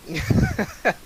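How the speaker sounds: high squeal of laughter; high-pitched laugh. Real, not acted.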